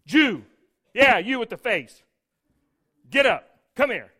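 loud barked orders; bossy soldier. Retold or imitated. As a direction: bark at him like an arrogant Roman soldier. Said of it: "he walks over and says".

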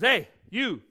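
loud barked orders; bossy soldier. Retold or imitated. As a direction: bark at him like an arrogant Roman soldier. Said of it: "he walks over and says".

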